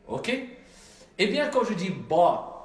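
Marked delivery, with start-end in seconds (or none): 1.9-2.5 BA with a heavy, rounded, dark A; Arabic tafkhim, a full-mouthed BA; emphatic. Shown as a demonstration. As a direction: fill the mouth on BA. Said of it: "ce qu'on appelle l'emphase en arabe".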